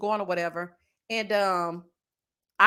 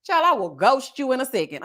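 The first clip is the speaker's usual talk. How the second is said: ordinary talk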